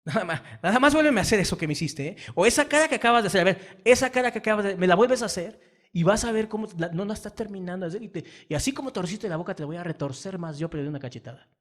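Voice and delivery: amenaza fuerte y rápida al hijo; regaña al hijo con furia fingida; amenaza de padre. Retold or imitated. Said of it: "hay que amenazar y decir". (padre amenazando al hijo por la mueca, fuerte)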